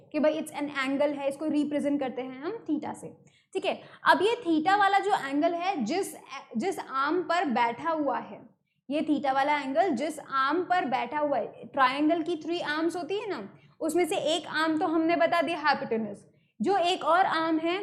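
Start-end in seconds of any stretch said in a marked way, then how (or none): none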